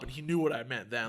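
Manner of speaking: ordinary talk, nothing marked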